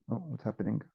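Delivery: ordinary delivery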